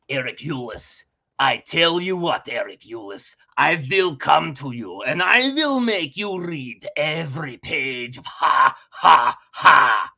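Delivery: disguise your voice, deep and threatening; hammed-up low threat ending in a shout; disguised voice. Shown as a demonstration. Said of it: "very easy for people to change their voice".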